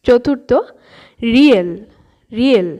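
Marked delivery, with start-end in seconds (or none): 1.3-2.7 Korean উচ্চারণে রিয়েল; Korean rieul demo. Shown as a demonstration. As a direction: spell out রিয়েল carefully, not like রিউল. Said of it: "এর উচ্চারণটা কিন্তু একদম রিউল হচ্ছে না".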